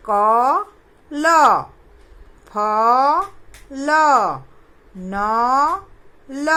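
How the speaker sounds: slow, loud, letter by letter; loud syllable reading. Shown as a demonstration. Teacher drilling children in syllables, slow and loud. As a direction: sound out each letter loudly for the class. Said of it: "जोर से थोड़ा".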